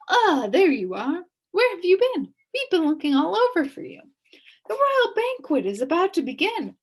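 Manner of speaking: high, quick fussing officials; fussy advisors. A narrator's reading. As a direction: bustle up in a hurry. King's advisors voiced.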